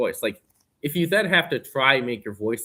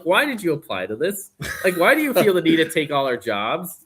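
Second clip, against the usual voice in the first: indignant rant; 7 semitones higher than usual. Retold or imitated. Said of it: "I'm like".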